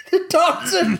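high grand proclamation; mock announcer. Host reading the synopsis as a mock announcer. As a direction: boom it like a title card.

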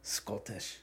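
low, soft Scottish accent. Shown as a demonstration. Testing the accent for a friend, soft scruffy Scottish. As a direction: try the word quietly in Scottish. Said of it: "Scottish is, like, kinda scruffy".

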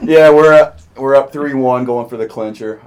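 loud gloating fan; excited. Real, not acted. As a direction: crow about the lead. Fan gloats about series lead.

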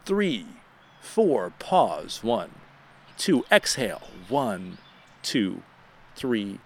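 slow, soft counted breaths; guided breathing. Acted. Host leading America through deep breaths, calm counting. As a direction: count the listeners through each breath calmly.

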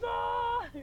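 long, high, drawn-out shout of the name; imitating a loudmouth praising at full voice; loud call. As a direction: call out to Jesus at the top of your voice, slowly. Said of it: "然后他就大声地喊".

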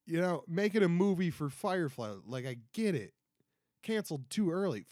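usual speaking voice

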